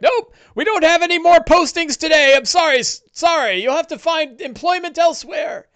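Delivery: a busy official shooing away adventurers; flustered mayor. Acted. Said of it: "He's like".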